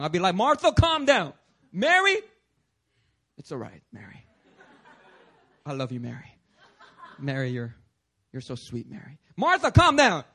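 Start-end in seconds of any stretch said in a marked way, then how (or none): 0.3-2.2 loud, high mock command; strong command. Playing Jesus settling the mourning sisters, strong command. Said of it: "I'll come in all strong". 3.4-9.2 low, soft mock comforting; tender consoling. Playing Jesus consoling Mary, tender and hushed. 9.4-10.2 sudden loud, high order; strong command. Pretend. Playing Jesus ordering Martha, strong loud command. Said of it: "I'll come in all strong".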